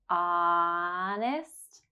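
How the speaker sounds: "Honest" with a long drawn-out ah; held ah. Shown as a demonstration. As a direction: stretch the ah of "Honest". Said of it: "hold ah for three seconds".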